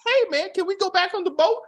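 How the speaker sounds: high, put-on voice of the clueless girl; high mocking impression of the girlfriend; mock whiny girlfriend. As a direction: mimic her airy request high. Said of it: "It's like".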